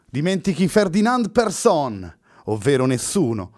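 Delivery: high, loud, slow cry from above; Luna thundering. A narrator's reading. Girl interrupting the ritual from the rocks, thundering high and slow. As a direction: boom it down from the rocks. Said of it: "tuonò la voce della ragazza".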